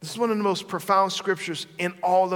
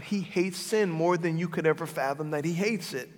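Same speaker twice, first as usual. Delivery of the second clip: normal talking voice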